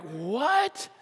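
soft, rising disbelieving question; retold disbelief. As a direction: ask it in quiet shock, voice rising. Retells shock at phone news.

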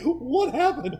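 high, loud, baffled store owner, imitated; mock astonishment. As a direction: exclaim it like a stunned shopkeeper.

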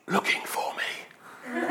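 put-on slow, secretive child whisper with a giggle; child's whisper. Hiding boy whispers the punchline to the salesman. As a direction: breathe it out like a hiding little boy.

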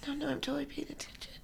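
hushed, fast whisper. Retold or imitated. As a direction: whisper it like you're faking attention. Retold stoned self, whispering.

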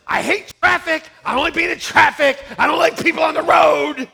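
loud, irritated ranting about traffic; exasperated griping, hammed up; grumpy complaint. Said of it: "It's like".

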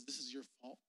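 low, very soft accusation; hushed blame. Acted. Grandmother pinning grandfather's death on mom, low and quiet.

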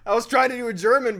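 laughing hard at your own botched attempt. Real, not acted. Friend owning up to a failed accent, laughing. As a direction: talk through real laughter, high and loud.

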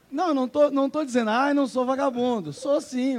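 loud, high, laughing protest; excited. Real, not acted. Joking self-denial, rising excitement.

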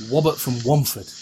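says Wobbert with a w for the r. Retold or imitated. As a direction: Womford said with w instead of r. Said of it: "He couldn't say his R's".

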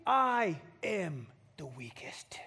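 slow breathy whisper; whispered confession. Acted. Gideon admitting to the angel, slow whisper. Gideon's final self-deprecation.

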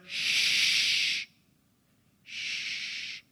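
hushed, breathy mock shush; a pastor mocking the hush of stuffy old churches, shushing. Pastor mimics a stuffy congregation, whispered mock shush. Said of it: "like some of the church services".

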